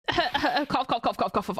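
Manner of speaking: spoken "cough" words as a mock cough; fake cough. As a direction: read "cough" aloud, badly faked. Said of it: "I don't wanna fake cough".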